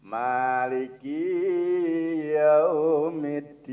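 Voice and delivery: Maliki with the first a drawn long, slow and loud; wrongly lengthened Maliki. Shown as a demonstration. Teacher shows the hidden error lahnul khofi. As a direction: stretch the a of Maliki too long. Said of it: "Lepat, masa Maliki? ... masa panjang".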